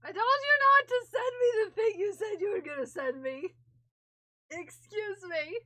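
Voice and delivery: loud, high, laughing mock scolding; excited mock outrage. Scolding Sam for sending the gift, loud and thrilled. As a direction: shriek it in delighted fake outrage.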